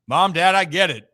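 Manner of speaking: ordinary delivery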